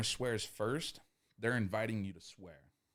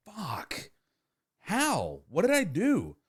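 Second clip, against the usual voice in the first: frustrated self-talk; 11 semitones higher, 7 dB louder, much slower than usual. Retold or imitated. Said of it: "that's how you feel".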